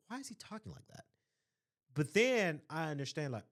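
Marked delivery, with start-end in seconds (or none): 0.1-1.1 hushed, high-pitched private thought; inner voice. Retold or imitated. Speaker replays the question in their mind, hushed and puzzled. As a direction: think it aloud very softly, puzzled. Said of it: "In my mind, I said".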